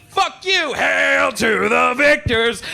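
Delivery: high yell stretching into a slow, drawn-out cry; hammed-up gloating shout. Michigan rival finding him shot in war, mock triumphant shout. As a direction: crow it over a fallen rival.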